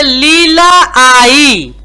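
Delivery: "Leela आई" stretched out slowly on its long vowels; slow, deliberate "Leela आई" for beginning readers; slow reading demo. Shown as a demonstration. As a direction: draw out each syllable of "Leela आई". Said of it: "बड़ी ई की मात्रा".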